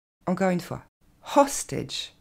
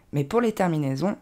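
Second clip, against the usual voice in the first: ordinary talk, nothing marked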